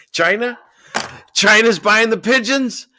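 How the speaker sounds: loud high-pitched disbelieving cry; shocked disbelief. Real, not acted. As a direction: blurt it out, stunned and loud. Shocked by pigeon news.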